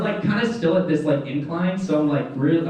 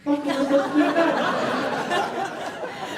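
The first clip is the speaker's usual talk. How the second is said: slow, high, disbelieving question; exasperated rise on every word; incredulous outburst; 12 semitones higher, much slower than usual. Retold or imitated. Answering the attendant's non-answer, slow incredulous outburst. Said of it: "I said".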